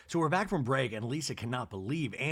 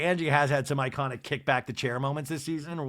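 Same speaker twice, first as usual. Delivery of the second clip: ordinary delivery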